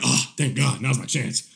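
a scheming aside, in imitation; mock relieved, dropped low; imitating the husband. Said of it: "He's like".

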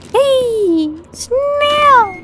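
high, stretched-out happy shout; over-excited. Real, not acted. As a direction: squeal it out with sudden excitement. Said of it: "I got excited for no fucking reason".

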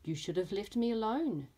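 lively storybook reading with voiced characters; reading to children. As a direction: read it like story time for little ones. Teacher reads picture book aloud.

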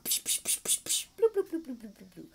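playful fireworks psh and bloop noises; kid-show sound effects; celebration sounds. Shown as a demonstration. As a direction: make silly celebration noises. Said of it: "Or whatever your celebration is".

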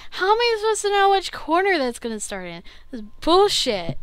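loud, high indignant complaint; frustrated gamer ranting; angry rant. Real, not acted. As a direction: vent the unfairness. Angry after losing.

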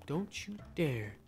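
very soft, slow, low warning; hushed warning. Real, not acted. Sheriff warning whoever is coming out, hushed warning. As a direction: warn under your breath, drawing it out.

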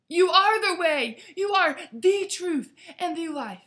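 loud, high cry of worship. Retold or imitated. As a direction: call it out loud in awe. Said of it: "and cry out".

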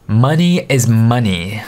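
ordinary delivery

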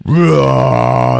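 long, drawn-out mock roar; huge roar imitation. Beast coming from the bushes, imitated roar. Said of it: "there's a huge roar".

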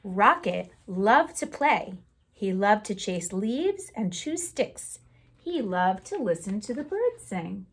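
put-on lively read-aloud for children; storytime reading. Teacher reading a picture book aloud to the class, lively storytime voice.

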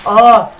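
sudden loud, high yelp; startled scream. Real, not acted. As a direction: cry out as if jumped. Startled by the game.

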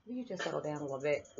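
quiet dad. Retold or imitated. Imitating her quiet dad telling her to calm down, soft and quick. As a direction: hush it like a dad calming her down. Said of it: "he's always quiet and reserved".

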